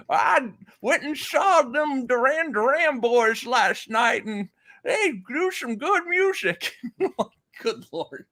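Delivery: put-on high cackling old man. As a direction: chuckle through it like an old timer. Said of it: "this old man thing here".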